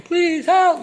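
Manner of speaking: high, loud pleading cry; very high-pitched retold yell; retold scream. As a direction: imitate a trapped boy screaming for rescue. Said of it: "And I'm screaming".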